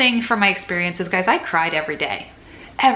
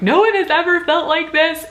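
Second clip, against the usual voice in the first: high, louder melodramatic whine; over-the-top self-pitying whine, much higher; mock dramatic whine; 10 semitones higher, 5 dB louder than usual. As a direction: play your younger self as a self-pitying drama queen.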